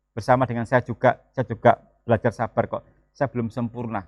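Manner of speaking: normal talking voice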